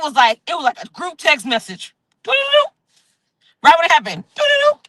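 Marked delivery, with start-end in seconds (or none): 2.2-2.7 high sung text-message ding; mock phone notification jingle sung high; text alert chime. Imitating group text alerts. 4.4-4.8 high sung text-message ding; mock phone notification jingle sung high; text alert chime. Imitating group text alerts.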